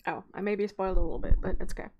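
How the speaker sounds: ordinary delivery, nothing marked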